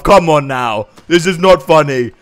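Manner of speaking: ordinary talk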